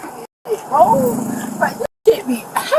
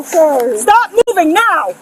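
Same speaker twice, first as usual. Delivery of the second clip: normal talking voice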